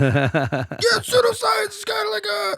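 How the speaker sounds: high, strained impression of Brian; Brian's voice. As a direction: squeal it out in Brian's voice. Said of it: "I'll just do it in his voice".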